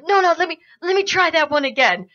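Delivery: fumbling would-be tough guy, imitated; hapless orc. Orc begging to retry a failed comeback, flustered. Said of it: "he's, like, really bad at the comebacks".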